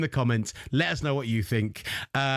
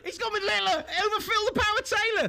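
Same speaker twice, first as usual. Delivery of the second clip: mock outrage; 13 semitones higher than usual. Mock commentator outrage at the pick.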